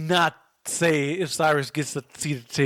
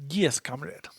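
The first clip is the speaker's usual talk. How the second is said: put-on Russian accent; 6 dB quieter, slower than usual. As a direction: answer like a Soviet comrade. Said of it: "can you do a Russian voice".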